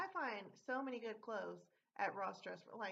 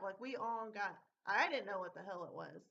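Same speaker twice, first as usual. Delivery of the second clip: normal talking voice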